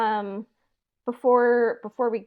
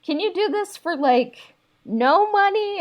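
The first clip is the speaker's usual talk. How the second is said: mock cheeky ask; 9 semitones higher, 5 dB louder than usual. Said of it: "it's like".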